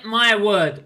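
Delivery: ordinary delivery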